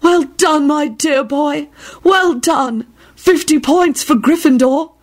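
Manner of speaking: put-on high, slow, fluttery old lady; dreamy seer praising. A narrator's reading. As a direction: gush like a dotty teacher. Dotty teacher praising boy.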